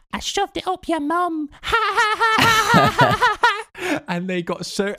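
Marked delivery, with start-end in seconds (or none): 0.1-1.5 put-on very high childlike voice, cheeky; high-pitched child voice. Still in child voice, taunting the angry opponents. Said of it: "So I kept going". 1.5-4.0 high laugh. Real, not acted. Laughing after taunt.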